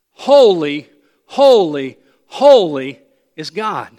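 every "Holy" loud and falling, set apart; emphatic threefold repetition. Shown as a demonstration. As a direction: proclaim "holy" three times with equal force. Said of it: "mentioned three times in succession".

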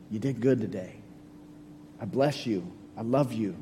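tender voice of God. Pretend. God's voice voiced to listener.

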